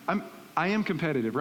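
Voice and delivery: normal talking voice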